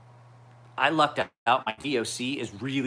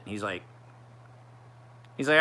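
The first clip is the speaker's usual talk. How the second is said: usual speaking voice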